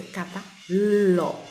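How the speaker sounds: cavalo stretched very slowly, syllable by syllable; sounded out slowly. Shown as a demonstration. Teacher reading the child's word aloud, slowly. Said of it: "E embaixo a mesma coisa".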